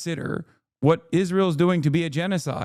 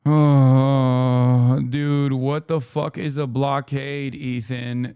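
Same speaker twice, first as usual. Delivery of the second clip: exasperated; 4 semitones lower, 3 dB louder, much slower than usual. Real, not acted. Exasperated outburst at Ethan.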